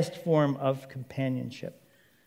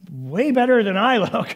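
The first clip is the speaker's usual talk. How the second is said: high, loud, wowed exclamation; thrilled, raised voice; excited; 6 semitones higher, 6 dB louder than usual. Acted. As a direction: blurt it out in delighted amazement. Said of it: "Man is so excited".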